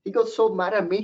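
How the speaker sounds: ordinary delivery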